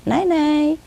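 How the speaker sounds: high singsong goodnight; parrot mimicking owner. Parrot copies owner's goodnight, high singsong. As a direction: sing out a high bedtime farewell. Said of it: "Exactly like my voice".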